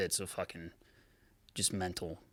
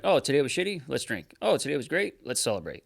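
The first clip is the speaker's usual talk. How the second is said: higher, louder, mock upbeat excuse-making; mock self-justifying voice; 6 semitones higher, 5 dB louder than usual. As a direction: parody your own cheerful excuses to drink. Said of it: "to be like".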